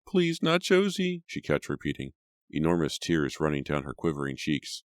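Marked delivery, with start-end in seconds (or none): 0.1-1.2 much higher, slow sobbing plea. A narrator's reading. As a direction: beg through sobs.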